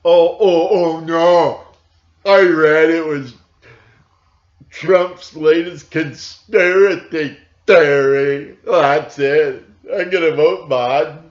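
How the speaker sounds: loud, high mock panic of a gullible voter; mocking Biden voter. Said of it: "you've seen it with Biden now".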